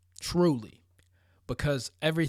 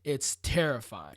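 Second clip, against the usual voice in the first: ordinary delivery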